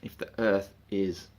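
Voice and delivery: normal talking voice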